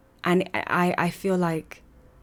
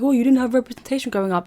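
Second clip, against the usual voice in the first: higher, louder, fast mock sympathetic tone; mocking people; 7 semitones higher, 5 dB louder, faster than usual. Mimicking people who pity her childhood, a fast mocking sing-song. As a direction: parrot them in a quick sing-song of pity. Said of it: "People are like".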